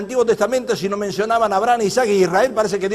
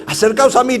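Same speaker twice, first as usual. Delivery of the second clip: projected, declaiming call; proclaimed aloud; 5 semitones higher, 5 dB louder than usual. Acted. Elijah summoning all the people, proclaimed aloud. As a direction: call it out firmly to a crowd. Same words proclaimed, contrast to soft take.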